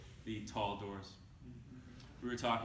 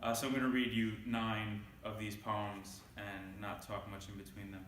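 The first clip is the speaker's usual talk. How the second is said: normal talking voice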